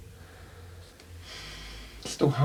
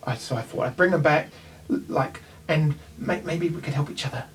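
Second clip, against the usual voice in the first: usual speaking voice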